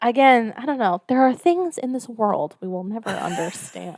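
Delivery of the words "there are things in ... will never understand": mock-ominous TV ghost hunter, hushed; Zach Baggins. Podcaster spoofing a ghost hunter about the unknown, softly ominous. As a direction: intone it softly like a paranormal show host. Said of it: "Zach Baggins was here".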